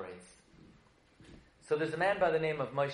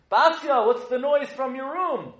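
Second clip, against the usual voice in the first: high, loud mock-Pharaoh call; hammed-up father calling his daughter; acted calling out; 7 semitones higher, 6 dB louder than usual. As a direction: call to someone in another room.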